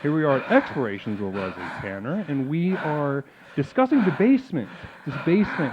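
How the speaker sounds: hushed late-night radio host; radio host recap. Acted. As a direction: soothe the listeners back in.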